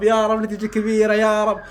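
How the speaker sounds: usual speaking voice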